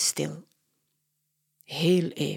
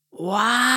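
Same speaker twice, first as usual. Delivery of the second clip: high, louder mimicked whoosh; imitated noise; 5 semitones higher, 4 dB louder than usual. Katja imitates a noise from the TV, mimicking. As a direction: copy the strange sound. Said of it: "Katja doet het geluid na".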